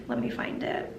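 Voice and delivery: under-breath whisper aside. Real, not acted. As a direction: whisper it to yourself. Muttered aside while searching.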